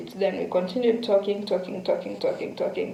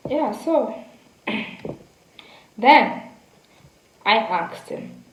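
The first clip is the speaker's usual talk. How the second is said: ordinary talk, nothing marked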